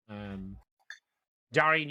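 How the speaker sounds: usual speaking voice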